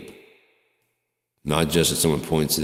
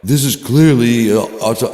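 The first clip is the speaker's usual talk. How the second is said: mock outrage; 7 semitones higher, 10 dB louder than usual. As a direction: ham up the know-it-all crowd. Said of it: "people are like".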